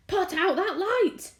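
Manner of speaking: high, slow, loud yell; angry shout. A narrator's reading. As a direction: hurl it at the ceiling. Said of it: "he shouted".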